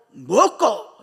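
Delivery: sudden loud barked command; father's shout. Retold or imitated. Father roaring at his family at the table, furious yell. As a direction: yell it like an angry father at dinner. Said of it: "소리를 꽉 지르는 거예요".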